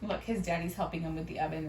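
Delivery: plain reading voice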